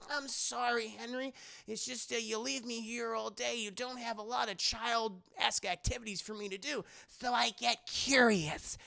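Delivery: put-on high-pitched kid voice; apologetic little girl. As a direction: explain yourself like a sorry child. Child apologises and explains herself.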